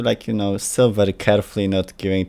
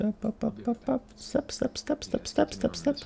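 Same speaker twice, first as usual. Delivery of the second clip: tiny high, soft pitter-patter voice; tiptoeing sounds; 9 dB quieter than usual. Pretend. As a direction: play sneaky little footsteps, high and hushed. Acting out stealthy tiptoeing.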